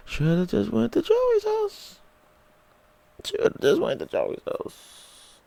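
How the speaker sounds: very high, slow whining; mock whimper of regret, fading to airy; hammed-up wishful lament; whiny regret. Regretful wish in story.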